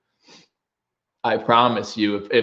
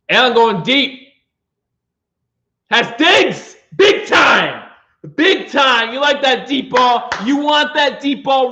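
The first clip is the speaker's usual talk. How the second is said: excited touchdown shout; 10 semitones higher, slower than usual. Real, not acted. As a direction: yell the big play like a fan. His team throws a touchdown.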